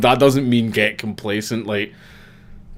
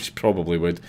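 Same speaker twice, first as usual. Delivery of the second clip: ordinary delivery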